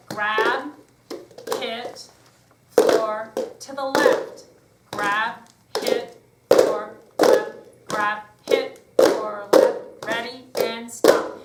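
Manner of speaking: punchy called-out moves, slowing at stop; loud rhythmic cues. Shown as a demonstration. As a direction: drive the class with your voice. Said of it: "I controlled the tempo".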